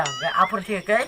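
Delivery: usual speaking voice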